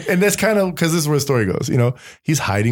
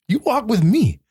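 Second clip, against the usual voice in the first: high exclaim; 13 semitones higher than usual. Retold or imitated. God's answer, sudden high voice.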